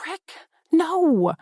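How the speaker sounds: a shocked, breathless protest; scandalized gasp. Acted. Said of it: "I gasp out, scandalized".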